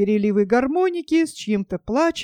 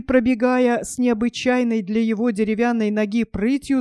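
ordinary delivery

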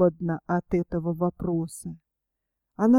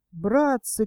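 ordinary delivery, nothing marked

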